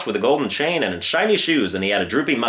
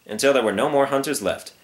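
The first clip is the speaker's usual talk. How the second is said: ordinary delivery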